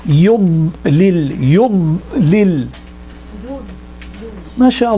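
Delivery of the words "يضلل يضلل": slowly drawn-out يضلل with a pressed ض; slow model reading. Shown as a demonstration.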